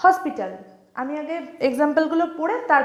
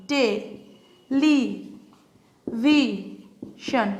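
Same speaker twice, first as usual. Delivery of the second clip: "Television" very slowly, long pauses between four syllables; much slower than usual. Shown as a demonstration. Teacher breaking up television for students, syllable by syllable. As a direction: isolate each syllable of "television". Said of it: "টেলিভিশনকে ভাঙি".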